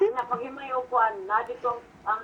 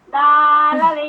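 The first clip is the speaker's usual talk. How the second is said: loud, long drawn-out call; 8 dB louder, much slower than usual. Real, not acted. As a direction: stretch it out loudly. Calling out a name repeatedly. (malakas at pahabang pagtawag)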